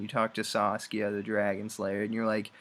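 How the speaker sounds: ordinary talk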